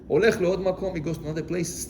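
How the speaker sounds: ordinary talk, nothing marked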